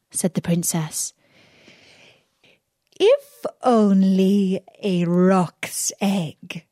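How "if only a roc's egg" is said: a lightly voiced sly villain posing as a holy woman; disguised magician voice. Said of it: "replied he".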